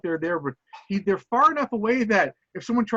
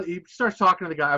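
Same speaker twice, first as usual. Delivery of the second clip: usual speaking voice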